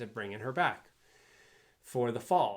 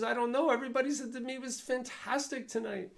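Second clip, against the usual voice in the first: defensive chef; 14 semitones higher than usual. Retold or imitated. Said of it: "He says".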